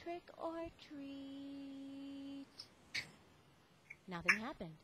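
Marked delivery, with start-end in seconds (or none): 0.0-2.5 slow, almost silent voice; very softly. Acted. Lance asks the fallen lady for candy, very softly. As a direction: murmur it so nobody hears. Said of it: "So he said very softly".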